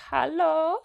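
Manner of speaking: put-on high-pitched German-accented greeting, rising. Imitating mother-in-law answering on the phone, German accent. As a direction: mimic a high German "hallo". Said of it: "I gave her a German accent".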